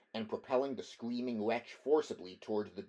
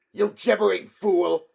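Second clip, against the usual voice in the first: much higher, much louder furious roar; disgusted roar; 10 semitones higher, 16 dB louder than usual. Acted. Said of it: "roared Dionys disgustedly".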